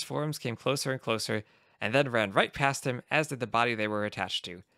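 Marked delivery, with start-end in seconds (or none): none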